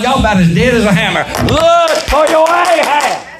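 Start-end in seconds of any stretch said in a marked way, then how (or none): 1.4-3.3 high, slow, straining preacher's cry of "Look for your Ahab"; preaching at full force. Acted. Preacher rouses a sleepy crowd with a slow high cry. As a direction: lift it up and stretch it out.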